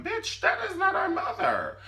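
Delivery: shrieking very high Benny. Retold or imitated. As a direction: squeal it way up in falsetto.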